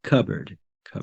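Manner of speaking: "cupboard" softly said CUB-erd; no P in "cupboard", just the B; silent P. Shown as a demonstration. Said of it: "The P is silent in this word".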